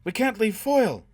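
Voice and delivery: high, loud desperate protest; crying out. A narrator's reading. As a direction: cry out in protest, pitch soaring. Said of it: "Gisbella cried".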